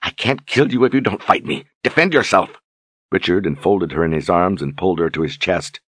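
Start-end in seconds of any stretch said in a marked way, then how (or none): 0.0-2.6 pleading demand. A narrator's reading. Berdine begging him to fight.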